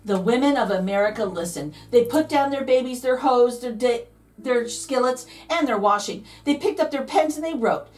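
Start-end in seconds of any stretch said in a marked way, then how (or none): none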